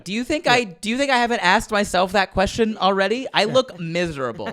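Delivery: high, laughing, sarcastic disbelief; exasperated retort. Retold or imitated. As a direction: throw the question back in mock outrage.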